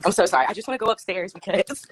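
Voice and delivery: ordinary talk, nothing marked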